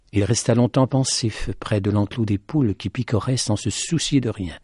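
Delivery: plain reading voice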